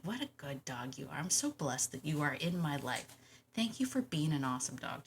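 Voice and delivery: soft, low and grateful; quietly, as if only thinking it; tender inward praise. Pretend. Owner silently thanking a resting dog, tender and hushed.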